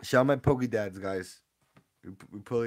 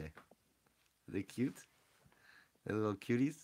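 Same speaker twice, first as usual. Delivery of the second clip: cooing; 7 dB quieter, slower than usual. Real, not acted. Cooing over babies.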